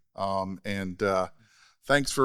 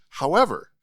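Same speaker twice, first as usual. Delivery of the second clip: loud, high, weighted however; ominous turn; 6 semitones higher, 7 dB louder than usual. Retold or imitated. Peggy pivoting to bad news, loud teasing however. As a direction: drop the however like a warning. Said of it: "And then she goes".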